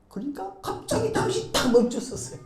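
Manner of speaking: ordinary talk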